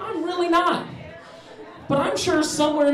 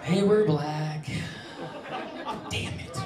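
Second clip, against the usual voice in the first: low, slow, soft reluctant answer; grudging admission; 7 semitones lower, 8 dB quieter, slower than usual. Retold or imitated. Narrator telling the 911 operator, low slow grudging answer. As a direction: drag it out unwillingly.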